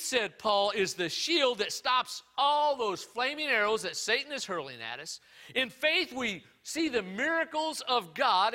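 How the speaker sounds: loud, high, fervent preaching; full-force preaching. Acted. Pastor preaching to his church, loud and fervent.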